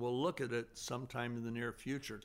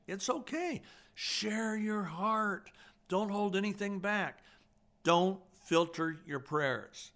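earnest urging; 6 semitones higher, 4 dB louder than usual. Real, not acted. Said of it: "urging listeners to share hearts".

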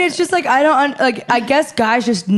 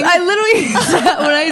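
laughing; 6 semitones higher, faster than usual. Real, not acted. Laughing while retelling.

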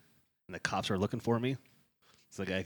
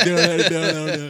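laughing; 11 semitones higher, 19 dB louder, much slower than usual. Real, not acted. Laughing at failed word.

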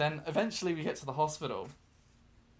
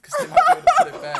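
fast, high quote through laughter; words rushed out amid loud laughter; laughing retelling; 17 dB louder, much faster than usual. Retold or imitated. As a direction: quote her while cracking up. Teller laughs at tooth request.

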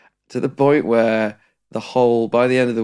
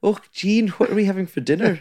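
put-on playful Scottish accent, pitched high and quick; jokey Scottish accent; 11 semitones higher than usual. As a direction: call to mum in a mock Scottish accent. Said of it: "call her in that accent".

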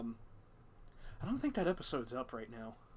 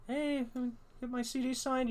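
lightly raised fan voice; 10 semitones higher than usual. Retold or imitated. Imitating fan asking.

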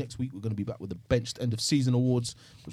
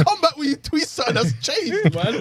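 higher voice, laughing throughout; 4 dB louder than usual. Retold or imitated. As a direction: say it while laughing. Laughing while retelling own reply.

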